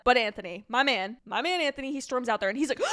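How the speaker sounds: ordinary talk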